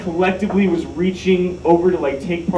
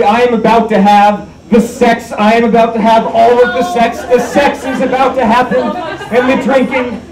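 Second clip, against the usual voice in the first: higher and louder, barely containing glee; giddy excitement; 7 semitones higher, 5 dB louder than usual. Real, not acted. Thrilled at coming party.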